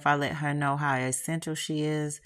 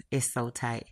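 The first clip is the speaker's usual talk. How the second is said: plain reading voice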